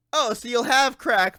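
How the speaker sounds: normal talking voice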